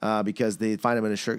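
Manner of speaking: usual speaking voice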